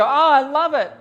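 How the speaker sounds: high, loud squeal of delight; delighted client. Retold or imitated. Couple sees their photos, squealing in loud high delight. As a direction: gush like a thrilled bride. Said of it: "they go".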